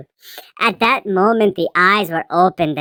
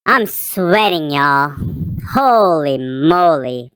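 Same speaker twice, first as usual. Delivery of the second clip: ordinary delivery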